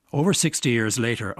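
ordinary talk

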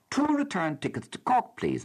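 high, light and innocent asking; innocent request. Retold or imitated. Letter writer at the ticket counter, mock innocent and high. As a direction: sweetly ask for the tickets. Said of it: "I said innocently".

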